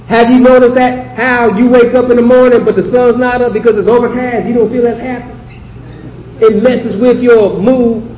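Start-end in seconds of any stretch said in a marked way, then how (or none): none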